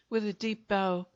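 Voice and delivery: plain reading voice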